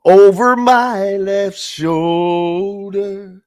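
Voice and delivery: slow and loud for comic effect; drawn out. Real, not acted. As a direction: stretch over my left shoulder out for effect.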